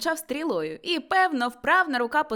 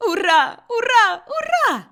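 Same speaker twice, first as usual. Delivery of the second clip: loud, high hurrahs climbing higher; jubilant cheering; 13 semitones higher, 10 dB louder than usual. A narrator's reading. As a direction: cheer at the top of your voice. Scrooge cheering in joy.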